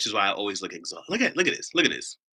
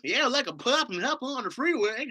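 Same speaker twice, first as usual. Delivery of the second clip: mock country bumpkin; 14 semitones higher than usual. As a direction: ham up a backwoods yokel. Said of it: "this is how they sound to you".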